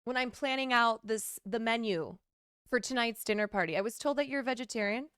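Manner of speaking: sweetly checking a dinner guest's diet; fake-polite hostess, bright and quick; mock hostess.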